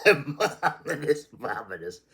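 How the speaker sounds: said through a high laugh; giggling delight, pitched high. Acted.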